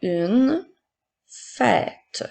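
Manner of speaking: word by word with pauses; careful dictation for pupils to write. Shown as a demonstration. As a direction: dictate slowly, each word set apart. Teacher repeating dictated words.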